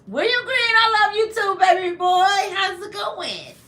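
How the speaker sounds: thrilled, high-pitched welcome to a viewer; gushing greeting. Real, not acted. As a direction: greet him like your favourite person.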